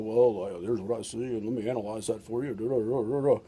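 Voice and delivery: lightly voiced analytic husband explaining; a hint of a calm, rational man; logical man. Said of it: "men tend to be more, like, logical".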